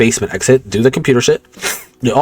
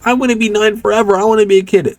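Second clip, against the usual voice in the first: put-on much higher little-boy voice; Hedwig child voice; 10 semitones higher than usual. Hedwig emerges during the speech, much higher child voice. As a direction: sound like a nine-year-old boy wishing. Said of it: "Hedwig comes out".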